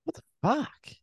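high, rising disbelief; can't believe what you heard; stunned disbelief. Real, not acted. Reacting to the no-leash story, high incredulous outburst.

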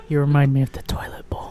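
unvoiced whisper; whispered line. Janitor whispering an insult to the customer, unvoiced whisper. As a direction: breathe it out as the janitor.

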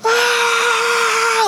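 high mock scream in the car. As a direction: let out a high scream, acting it out. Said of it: "started screaming".

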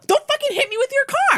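high, loud furious retort; acted-out high yell; angry yelling. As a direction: shout it at the driver, outraged. Retold shouted quarrel with driver.

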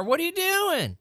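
shocked, high-pitched question; incredulous. Real, not acted. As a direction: ask in horrified disbelief. Reacting to Kit Kat video.

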